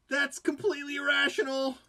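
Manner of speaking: high, loud comic outburst; mock outraged shout. Sudden comic outburst at own guilt.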